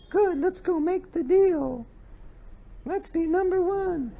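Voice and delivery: very high, slow mock businesswoman; too-high woman's voice. Shown as a demonstration. Woman closing a business deal, voice far too high. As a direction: pitch it way up, unbusinesslike. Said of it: "if they speak too high, it sounds like a woman".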